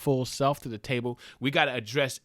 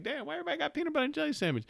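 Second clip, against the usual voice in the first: baffled quote; much faster than usual. Retold or imitated. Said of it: "You're like".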